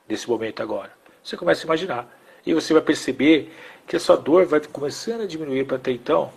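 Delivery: soft, low guided-imagery voice; guided visualisation. Acted. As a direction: gently lead the listener into the image. (visualização guiada, voz baixa e suave)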